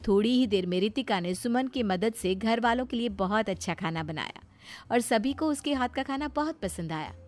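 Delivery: ordinary delivery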